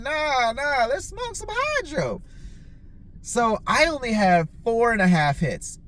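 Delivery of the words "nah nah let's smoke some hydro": high, drawn-out eager reply; eager agreement. Retold or imitated. Friend offered hydro, retold high-pitched eager reply. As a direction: re-enact an eager newcomer.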